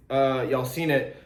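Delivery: ordinary delivery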